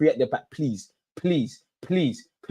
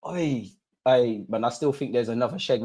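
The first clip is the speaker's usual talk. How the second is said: ordinary delivery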